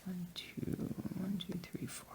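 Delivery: counting stitches in a whisper. Real, not acted. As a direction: whisper the count under your breath.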